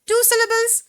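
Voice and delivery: ordinary talk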